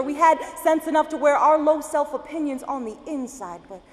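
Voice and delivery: impassioned, rhythmic slam poetry delivery; spoken-word poem. Acted. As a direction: recite it as a driving poem, rising in force.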